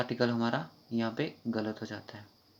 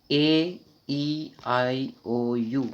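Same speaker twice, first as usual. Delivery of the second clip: drawn-out vowels; 4 dB louder, much slower than usual. Shown as a demonstration. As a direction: stretch each vowel very slowly. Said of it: "सबसे पहले sound देखो".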